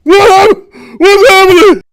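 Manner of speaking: loud high panicked "what's happening"; panicked scream. Acted. Loud high panicked scream. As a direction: Harvick screaming as the boy bleeds. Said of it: "Harvick's again".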